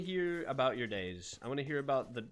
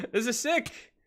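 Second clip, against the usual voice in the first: excited exclamation; 12 semitones higher, 9 dB louder than usual. Real, not acted. Excited reaction to pulsating tree.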